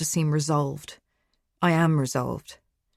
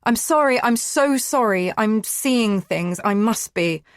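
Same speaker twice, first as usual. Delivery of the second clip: pleading; 6 semitones higher, 5 dB louder, slower than usual. Acted. Distraught woman begs forgiveness.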